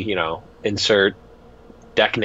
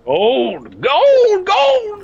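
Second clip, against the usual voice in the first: high squeaky prospector crying out for gold; hollering gold like a crusty old miner; 5 dB louder, slower than usual. Pretend. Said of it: "You're a crusty old prospector".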